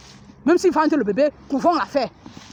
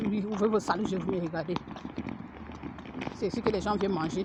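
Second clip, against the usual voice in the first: much softer, low undertone while filming; filming a place secretly, hushed; hushed, discreet voice; 7 semitones lower, 10 dB quieter than usual. Real, not acted. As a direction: keep it low so no one notices. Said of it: "de façon distraite".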